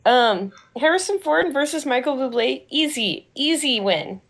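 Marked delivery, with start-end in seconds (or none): none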